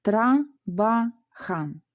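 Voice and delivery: "Тра-ба-хан" very slowly, in three detached syllables. Shown as a demonstration. Teacher first dividing the word for learners, slow syllable by syllable. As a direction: pause after each syllable of "Тра-ба-хан". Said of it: "Спочатку розділимо на склади".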